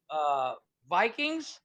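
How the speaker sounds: normal talking voice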